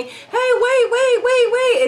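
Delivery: high urgent calls; calling out. Retold or imitated. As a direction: call after someone running. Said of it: "my friend telling me".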